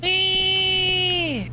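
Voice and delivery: high squealing whee; gleeful whee. Real, not acted. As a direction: squeal it out with glee. Gleeful cry while running.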